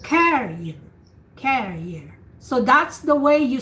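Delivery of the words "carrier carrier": carrier with the CA stressed and the end nearly whispered; teaching model of carrier, falling off at the end; stress on first syllable. Shown as a demonstration. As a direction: lean on CA in carrier. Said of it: "you whisper the second syllable".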